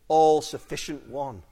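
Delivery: ordinary talk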